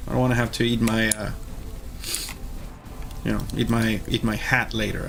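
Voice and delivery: ordinary delivery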